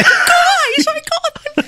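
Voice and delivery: high-pitched puppet voice, laughing; Kermit. Acted. Co-host shows off after the scene, Kermit impression. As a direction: put on a high, silly frog voice. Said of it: "my Kermit impression was way better".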